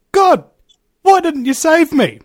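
high, loud complaint; indignant protest. Retold or imitated. Drowned man confronts God at pearly gates, indignant protest.

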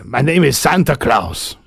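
put-on lightly accented Santa Claus character. Host introducing himself as Santa, put-on accent. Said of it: "I think the accents are about the best".

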